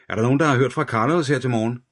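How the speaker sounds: calling out to the whole room; detective shouting a question across the office, loud and quick; called out. A narrator's reading. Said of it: "råbte han ud over lokalet".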